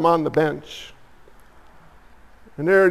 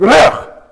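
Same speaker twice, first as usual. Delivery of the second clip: a loud, higher mock dog's ugh of disgust; dog's disgusted grunt; 6 semitones higher, 7 dB louder than usual. Dog rejecting the fresh water, loud mock ugh of disgust. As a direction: play the dog turning up its nose, loud. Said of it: "He's like".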